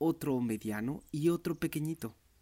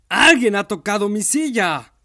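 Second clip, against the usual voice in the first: big bear shouting; 8 semitones higher, 16 dB louder than usual. A narrator's reading. Papa bear roaring about his chair, a big loud shout. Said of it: "El oso grande gritó muy fuerte".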